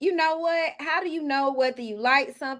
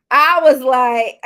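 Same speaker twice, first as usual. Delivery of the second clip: ordinary talk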